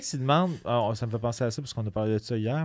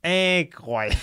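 INCROYABLE pitched higher in a put-on voice; showy acting range; 5 semitones higher, 4 dB louder, much slower than usual. Shown as a demonstration. Comedian showing off his acting range, hammed up and high. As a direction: overact INCROYABLE, high and emphatic. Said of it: "un registre de jeu incroyable".

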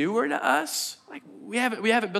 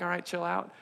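dismissive aside; 11 dB quieter than usual. Acted. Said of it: "we're like".

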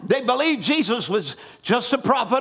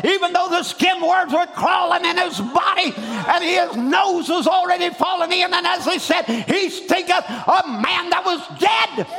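shouted preaching, climbing higher; fervent preaching; 6 semitones higher than usual. Acted. Preacher roaring to the congregation about Lazarus, shouted. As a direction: build it to a full preacher's cry.

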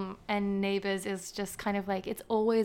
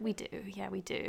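dry whisper; 6 dB quieter than usual. Retold or imitated. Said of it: "I was like".

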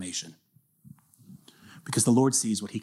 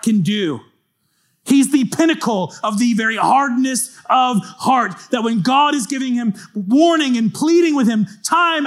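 high, loud, driving sermon delivery, building higher; impassioned preaching; 8 semitones higher, 5 dB louder than usual. Real, not acted. Preacher warning the congregation about Pharaoh's hard heart, impassioned and high. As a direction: preach it with rising urgency and punched key words.

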